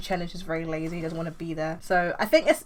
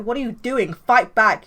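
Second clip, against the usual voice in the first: loud shout, rising at the end; yelling; 4 semitones higher, 10 dB louder than usual. Acted. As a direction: yell at him to fight. Nova yells at Chen.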